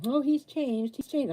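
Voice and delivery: high mocking voice of relatives; mocking others. Mimicking relatives who insist her ex has changed, mocking and high. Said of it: "they keep telling me".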